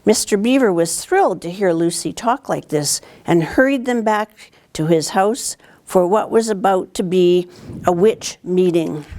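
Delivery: plain reading voice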